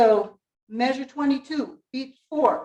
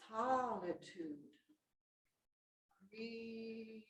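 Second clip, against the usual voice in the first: "solitude" and "grief" dropping away much softer; softening down; 3 semitones lower, 23 dB quieter, much slower than usual. Shown as a demonstration. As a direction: let "grief" fade almost to nothing. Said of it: "we need to get to the important word".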